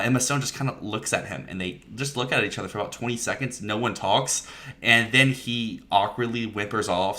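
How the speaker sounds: usual speaking voice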